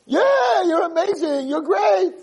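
loud, very high mock cheer; hammed-up gushing praise; feigned enthusiasm. Father overpraising his failing son, feigned enthusiasm.

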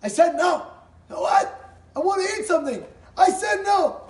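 high, loud snapping back and forth; heated, mock-quarrel shouting; acted marital quarrel. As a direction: argue at full pitch over dinner. Husband and wife quarrel over dinner.

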